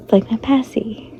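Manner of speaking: sheepish whisper. Real, not acted. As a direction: whisper it shyly. Confessing a comfort object.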